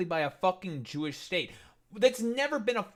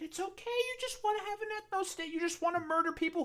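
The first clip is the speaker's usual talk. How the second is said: mock sympathy; 11 semitones higher, 5 dB quieter, faster than usual. Mock soothing taunt.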